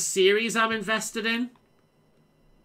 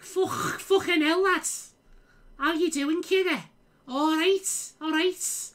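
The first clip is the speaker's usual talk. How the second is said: high-pitched, hammed-up Scouse; fake Scouse accent; 11 semitones higher, slower than usual. Streamer failing to fake own accent, hammed-up Scouse. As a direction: put on an awful exaggerated Scouse, way up high. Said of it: "if I try and fake the Scouse accent".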